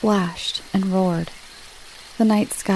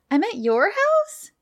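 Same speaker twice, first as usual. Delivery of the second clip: much higher, indignant rising question; stern question; 14 semitones higher than usual. A narrator's reading. Girl finds she is at Levi's house, mildly stern. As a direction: demand it with pitch shooting up. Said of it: "Kingsley said sternly".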